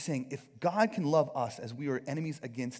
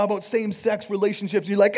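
usual speaking voice

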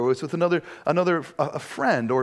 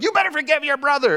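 high, loud mock mom scolding; imitating mom; 12 semitones higher, 5 dB louder, faster than usual. Pastor imitates his mom ordering the boys to make up, high and loud. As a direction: play the bossy mom ordering the kids. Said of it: "my mom would come down, and she's like".

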